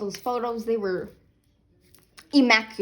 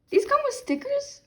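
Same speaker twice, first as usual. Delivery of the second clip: startled confused question; 3 dB louder than usual. Real, not acted. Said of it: "I'm confused".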